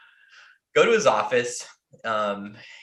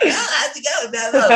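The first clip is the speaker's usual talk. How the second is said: high, loud, laughing smug greeting; breezy cocky hello through a laugh; smug buddy; 8 dB louder than usual. Retold or imitated. Buddy leaving the orgy, greeting him with a laughing high cocky hello.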